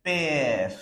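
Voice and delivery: "père" with a long open "eh" before the R; prolonged E. Shown as a demonstration. Teacher shows accent grave, "père" with lengthened E. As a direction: stretch the vowel in "père". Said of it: "pronounced for a longer period".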